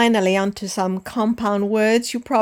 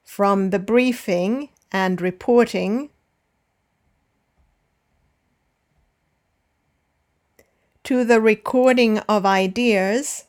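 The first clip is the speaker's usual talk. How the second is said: measured listen-and-repeat reading; dictation read. Shown as a demonstration. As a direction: read it out in short chunks, pausing for repetition. Slow dictation read with long pauses.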